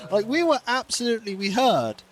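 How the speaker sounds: ordinary talk, nothing marked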